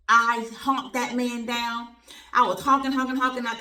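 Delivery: loud excited honking story; excited retelling. Retold or imitated. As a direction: relive the honking. Retold honking at the man.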